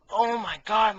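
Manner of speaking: high, loud overjoyed exclaim; thrilled boss gushing; delighted gush. Acted. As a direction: burst out thrilled.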